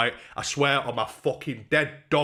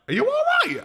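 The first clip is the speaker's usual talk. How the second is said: very high, louder acted check-in; Lineker; 5 dB louder, faster than usual. Mate checking on his punched friend, high mock-urgent cry. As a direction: pitch it right up, imitating an alarmed mate. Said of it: "my mate Lineker was like".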